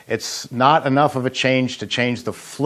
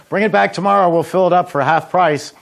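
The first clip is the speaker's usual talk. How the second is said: much higher, upbeat seller calling out; cheery vendor call; 6 semitones higher than usual. Retold or imitated. Vendor calling after a leaving beach customer, high cheery pitch. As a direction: sell the refill offer with a bright pitch lift. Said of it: "I say".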